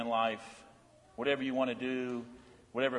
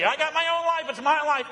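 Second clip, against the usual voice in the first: higher, mocking rebellious-teen voice; defiant claim; 13 semitones higher, 5 dB louder than usual. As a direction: sneer it like a stubborn kid. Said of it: "how dare I say".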